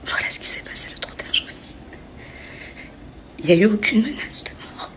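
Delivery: real hushed whisper, fighting tears; real whisper while upset. Real, not acted. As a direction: whisper it, barely holding together. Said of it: "je vais me calmer".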